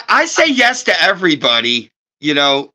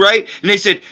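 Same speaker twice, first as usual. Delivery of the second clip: normal talking voice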